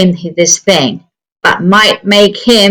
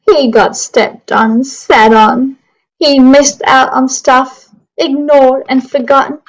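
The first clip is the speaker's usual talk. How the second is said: lively storybook narration for a class; reading to children; 3 semitones higher than usual. Acted. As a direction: read it like story time on the carpet. Reading picture book aloud.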